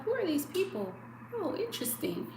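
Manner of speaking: puzzled little cat meeting strangers; curious cat voice. Retold or imitated. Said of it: "she was like".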